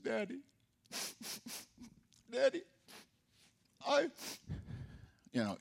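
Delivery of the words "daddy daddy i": put-on small whimpering boy pleading with dad; a child begging his father not to punish him; mock crying plea. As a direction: put on a tearful kid's voice. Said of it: "You really had to put on a performance".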